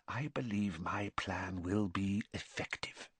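very soft, cold, measured whisper; whispered near-hiss. A narrator's reading. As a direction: hush it right down, hissing with quiet resolve. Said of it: "whisper that was almost a hiss".

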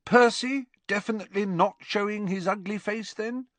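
high-pitched, cheeky inquiry; needling, high-voiced question; pointed question. A narrator's reading. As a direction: ask it lightly but pointedly, pitched high. Fred pointedly asks about brother.

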